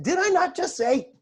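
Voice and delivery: high-pitched comic scolding; exaggerated mock reproach. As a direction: act out exasperated reminding, voice jumping up. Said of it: "Probably didn't say it like that".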